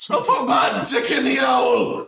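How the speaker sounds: high, drawn-out mock shout; Hagrid. Hagrid boasting about the owl, in a high mock shout. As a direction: holler it slowly in a silly high voice. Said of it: "and Hagrid is just, like".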